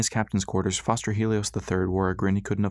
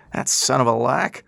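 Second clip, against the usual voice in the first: slow, louder angry growl; angry curse; 4 semitones higher, 5 dB louder, slower than usual. Acted. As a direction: curse the traitor under strain. Pop cursing the enemy.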